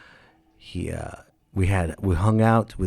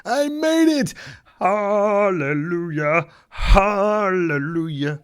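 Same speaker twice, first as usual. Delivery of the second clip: high, crying shout of relief, drawn-out hallelujahs; relieved cry to heaven; 12 semitones higher, much slower than usual. Retold or imitated. Finally reaching Coos Bay, arms up in the rain, he cries out in relief. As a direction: cry out like a freed prisoner in the rain. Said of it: "like Andy Dufresne from Shawshank Redemption".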